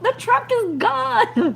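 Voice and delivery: high, loud and slow, dragging out the alarm; panicked report. Retold or imitated. To the safety guy on the phone, a panicked high cry. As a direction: spell out the disaster in a stunned high wail.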